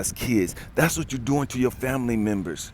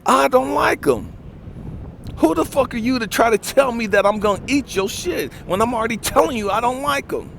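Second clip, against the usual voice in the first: loud, high, heated telling-off; angry boyfriend shouting down his girlfriend; angry retort; 8 semitones higher, 4 dB louder than usual. Retold or imitated. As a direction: let it rip, fed up. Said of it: "I said".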